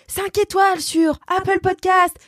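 high, slow proclamation; excited read. Acted. Host reading a fan's review to the team, jubilant. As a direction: announce it like a rave review.

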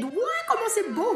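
high gushing wow; mock wonder. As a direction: gasp in awe at it. Mock awe at LED garland.

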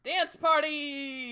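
high, slow, loud party call; excited cheer. Acted. Cheering the film's ending.

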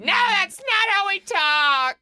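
high, loud, drawn-out protest; mock outraged yell. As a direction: holler it slowly in comic outrage. Mock outburst at bad TV accents.

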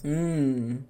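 ordinary talk

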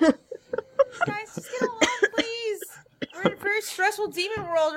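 high-pitched pleading with squabbling friends; exasperated plea. Acted. Teammate begs the squabbling party to get along, exasperated plea.

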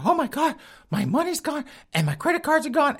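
high, hammed-up falsetto of a frantic woman; panicked woman. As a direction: play the victim, squeaky and frantic. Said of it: "tells the neighbor".

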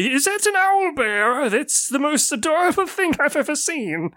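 put-on high, eager old man voice; old shopkeeper delighted to greet customers. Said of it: "and he's like".

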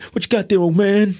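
high-pitched, taunting movie-quote voice; mock gangster challenge. Voicing film gangster confronting the man.

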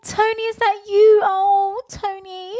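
very high, loud, breathless gush; gushing fangirl. Retold or imitated. Mocking Lou gushing over Tony in the café. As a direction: squeal like a smitten fan. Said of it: "you were like".

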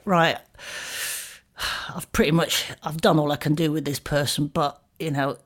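low, dry therapist inner voice; imitating a lazy therapist. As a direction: voice the cynical counsellor lightly. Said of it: "they get to a point where they're like".

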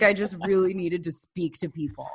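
normal talking voice

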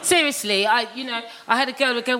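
usual speaking voice

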